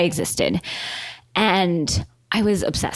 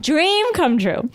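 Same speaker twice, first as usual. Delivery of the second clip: dreamy; 8 semitones higher, much slower than usual. Acted. Savoring dream moment.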